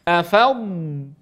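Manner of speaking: أفض with a long drawn-out ض; held ض sound. Shown as a demonstration. Teacher to students, holding the ض so they hear it. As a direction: stretch the ض at the end of أفض. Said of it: "سامعين الصوت هذا؟".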